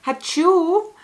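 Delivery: tiny high squeaky "Hachoo!"; cute sneeze. Shown as a demonstration. Showing viewers what a cute sneeze sounds like, squeaky mock sneeze. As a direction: mimic a dainty little sneeze, high and loud. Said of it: "a cute sneezing sound like this little".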